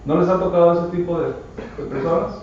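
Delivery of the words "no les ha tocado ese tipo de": normal talking voice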